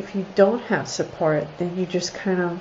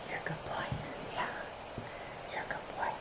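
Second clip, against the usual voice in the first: breathy whispered praise, almost silent; whisper to dog; 20 dB quieter, much faster than usual. Real, not acted.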